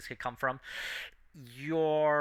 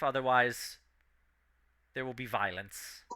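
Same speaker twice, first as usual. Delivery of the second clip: lightly voiced commanding telepathic brain; telepathic villain voice; 5 dB louder than usual. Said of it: "telepathic voice appears in your mind".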